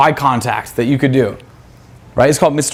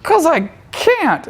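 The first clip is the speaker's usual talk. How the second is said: high, slow, dragged-out voice of an ugly guy; mock the troll, drawn out and high; a hammed-up ugly troll turning her down; 14 semitones higher, much slower than usual. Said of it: "And he's gonna be like".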